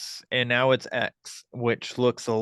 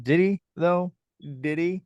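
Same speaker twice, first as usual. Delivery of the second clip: skeptical; 7 semitones higher than usual. Retold or imitated. Skeptical reply to fans.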